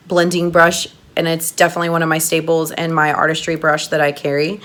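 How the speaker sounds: ordinary delivery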